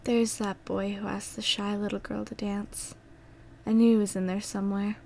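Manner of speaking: high, soft, fragile voice; weak and tender. Acted. Dying Anna smiles at Thomas, weak tender voice. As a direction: speak faintly and fondly.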